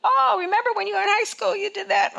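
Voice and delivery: high-pitched mock reminder; taunting enemy. Enemy reminding you of every wrong, high and taunting. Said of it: "The enemy's gonna mess with your mind".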